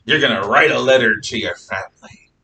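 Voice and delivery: hammed-up pirate giving orders. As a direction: play a storybook pirate captain. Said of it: "I'm gonna use the pirate voice".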